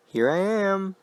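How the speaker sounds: high, thin falsetto mother; unnatural falsetto. Acted. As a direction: float up into a creepy falsetto. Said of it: "Her voice was an unnatural falsetto".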